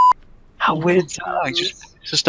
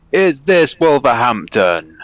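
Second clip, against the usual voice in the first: slow, louder mock Russian accent; imitated Russian accent; 3 semitones higher, 5 dB louder, slower than usual. Russian stranger asking a passenger on the train, imitated Russian accent. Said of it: "a crazy Russian guy on the train".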